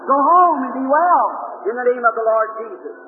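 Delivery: ordinary delivery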